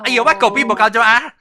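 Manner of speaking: mock complaining. Showing how a complainer gripes, mock complaining.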